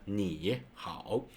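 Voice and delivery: 你好 as two slow, separate full dipping tones; over-careful third tones. Shown as a demonstration. Teacher showing the weird way to greet, over-careful dipping tones. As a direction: draw out 你好, each syllable going down then up. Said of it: "If you speak this way, it's pretty weird".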